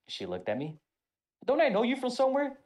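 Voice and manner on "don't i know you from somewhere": high, loud drill sergeant voice. Retold or imitated. Drill sergeant recognising him in a restaurant, high and loud. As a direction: bark it like she's squinting at him.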